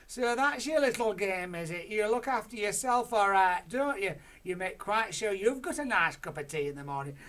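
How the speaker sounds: high, very loud jeering; Grandma jeers at the family, high and loud; mocking accusation. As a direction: rub it in.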